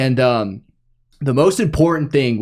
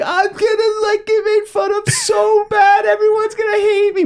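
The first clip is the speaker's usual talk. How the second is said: put-on much higher, wailing kid voice through tears; imitating bawling kid. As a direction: whine it like a sobbing seventh grader. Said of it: "I just start bawling my eyes out".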